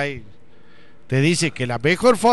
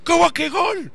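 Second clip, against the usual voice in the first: much higher, confused yelling; baffled outcry; 14 semitones higher, slower than usual. Retold or imitated. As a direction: shout in disbelief from the balcony. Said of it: "sale al balcón".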